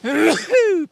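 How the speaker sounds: sudden loud cough into the mic. Real, not acted. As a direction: cough hard, right in the listener's ear. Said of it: "That's probably right in your ear hole".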